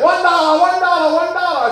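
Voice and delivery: high, loud haggling vendor cry; caricatured knife seller shouting his price; mock street hawker. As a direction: hawk knives high and loud, pitching hard. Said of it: "He's waving knives like".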